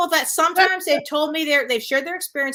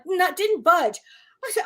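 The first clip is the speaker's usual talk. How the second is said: normal talking voice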